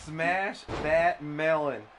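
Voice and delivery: very slow, drawn-out mock catchphrase; imitated scream. Echoing the co-commentator's favorite Gallagher bit, slow mock scream. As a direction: stretch each word out, relishing it. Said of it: "and he screams".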